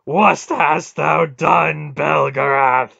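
wrathful god accusing; thunderous accusation. A narrator's reading. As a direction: hurl the charge at full voice. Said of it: "Mara accused".

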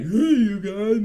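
put-on high, loud, slow drunken "Hey, you guys"; sloppy drunk calling out to friends; hammed-up tipsy hello; mock drunk greeting. Mimicking being drunk.

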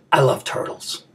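soft, low, holding back emotion; subdued and choked up; getting emotional. Acted. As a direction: say it quietly, trying to keep it together. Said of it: "I'm getting a little emotional".

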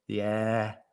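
usual speaking voice

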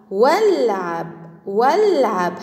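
"والعب" drawn out slowly for pupils to repeat; slow pronunciation demo. Shown as a demonstration. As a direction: model "والعب" slowly, joining the prefix to the word. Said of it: "هيا انطقها".

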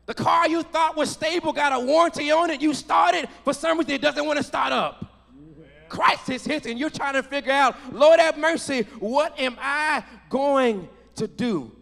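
sermon delivery building in intensity; impassioned preaching. Real, not acted. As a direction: preach it with rising force, punching key words. Preaches about sudden crisis.

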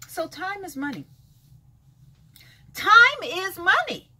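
normal talking voice

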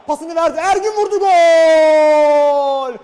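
high, rising roar into the goal; screaming football commentator. Real, not acted. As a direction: explode on the goal. Live football goal call. (gol anında haykıran spiker)

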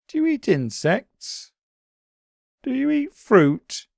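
high, small puzzled bunny voice; a high, wondering little creature; Daily B's voice. A narrator's reading. Daily B asks.

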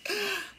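sharp high delighted gasp; surprised gasp. Real, not acted. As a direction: gasp at the find. Spots the pasta sauce.

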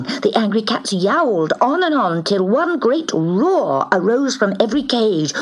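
usual speaking voice

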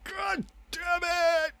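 very high, drawn-out squeal of frustration; real high-pitched exasperation; exasperated cursing. Real, not acted. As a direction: stretch the curse out in a high squeak. Curses during play, voice soars.

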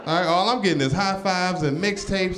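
usual speaking voice

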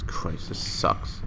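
under the breath; whispering. Real, not acted. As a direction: whisper it in disgust. Frustrated muttering to self.

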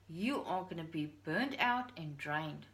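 normal talking voice

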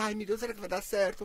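lightly voiced worrier; a hint of mock fretting; anxious inner self. Host imitating the caller's anxious side, mild mock worry. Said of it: "como ela é ansiosa".

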